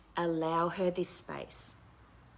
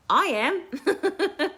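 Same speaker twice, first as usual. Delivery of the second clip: laughing admission; 13 semitones higher, 8 dB louder than usual. Real, not acted.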